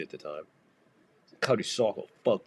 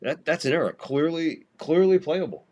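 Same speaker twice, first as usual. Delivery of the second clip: rising, louder outraged complaint; indignant protest; 7 semitones higher, 5 dB louder than usual. Real, not acted. Fan yelling at the umpire's error, heated protest. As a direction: protest the bad call, heated.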